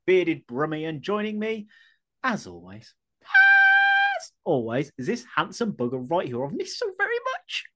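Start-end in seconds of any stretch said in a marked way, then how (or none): 3.2-4.9 high, slow shouted repeat; shouted drawn-out. Pretend. Host hamming up the intro, stretched shout. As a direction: belt it out, stretched.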